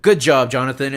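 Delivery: loud, hammed-up captain getting the name wrong; captain's praise. Captain congratulating Benethan, loud mock praise. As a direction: boom it out like a hearty captain. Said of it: "He's like".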